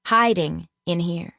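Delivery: usual speaking voice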